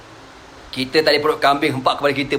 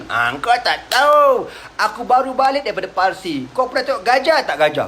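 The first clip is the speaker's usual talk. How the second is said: much higher, showy hawker's voice; boastful storyteller; 6 semitones higher than usual. Retold or imitated. Nadr pulling listeners from the Prophet, high hawker voice. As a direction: lure the crowd away, higher and showy.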